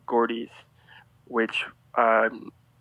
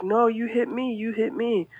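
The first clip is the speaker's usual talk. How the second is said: mock high-pitched woman insisting; arguing woman; 11 semitones higher than usual. Driver blaming him after backing into him, mock high insistence. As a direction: imitate her higher, indignant comeback. Retells roadside argument with woman.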